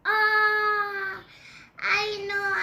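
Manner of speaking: playful pretend alarm; startled cry. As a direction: yelp as if caught. Caught dinosaur cries out.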